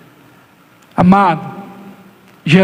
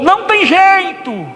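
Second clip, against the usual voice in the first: high, slow hopeless lament; a believer losing hope in the storm; despairing; 12 semitones higher than usual. Retold or imitated. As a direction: give up in despair, drawn out.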